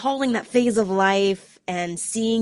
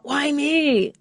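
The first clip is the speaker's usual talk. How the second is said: rising anguished why me; pitched up in self-pity; mock complaining why me; despairing why me; 7 semitones higher than usual. Shown as a demonstration. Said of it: "intonation changes everything".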